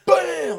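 a loud, high "Bam!" punching out the impact; slam sound effect. Retold or imitated. Telling friends how the kid got dumped, loud mock impact. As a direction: smack the word out like a body hitting the ground.

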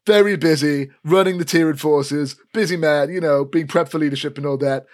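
loud taunting sneer; smug cousin. Acted. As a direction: belittle your cousin, gloating. Elfgeet brags.

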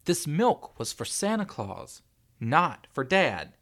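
slow, high, loud emphasis; indignant child spelling it out; stubborn insistence. A narrator's reading. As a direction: insist deliberately, word by word. Stubborn boy insists about the milk.

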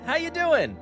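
ordinary delivery

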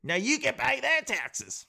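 put-on high, drawn-out British accent gone Australian; mock British. England punishing Canada with taxes, high mock-British rant. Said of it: "British accent and not go Australian".

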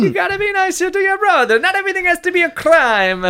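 a loud, high, cartoon Italian-American mamma; Italian-American accent. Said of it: "I can do this accent forever".

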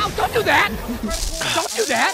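high yelp of hurt; high, alarmed complaint; pained protest. Acted. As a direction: cry out as if just stabbed. Stabbed friend protests.

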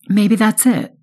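bright, lifted voice, pitch up; suddenly hopeful and bright; brightened. A narrator's reading. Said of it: "I brighten".

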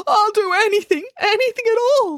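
high desperate promise; desperate small boy. Acted. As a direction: plead eagerly to make amends. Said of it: "said the boy".

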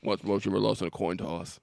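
low, lightly voiced menacing villain; Javier Bardem. Podcaster quoting a gas station coin toss scene, low villain impression. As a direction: drop low for a sparse killer impression. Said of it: "It feels like Javier Bardem in".

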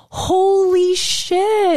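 drawn-out, high holy-wow; awestruck. Real, not acted. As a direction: stretch it out in amazement, high. Awestruck climax about being alive.

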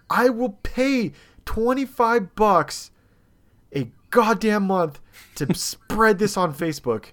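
high, emphatic, indignant threat; retold rant. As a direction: rant word by word, a laugh slipping in. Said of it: "I go through this rant".

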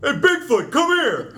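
high, loud street yell; New Jersey holler. Pretend. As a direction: holler across the block for Bigfoot. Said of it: "that's the New Jersey Bigfoot".

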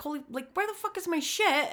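spinning around hunting for lost keys; retold frantic outburst; frantic disbelief. Frantic search for her keys.

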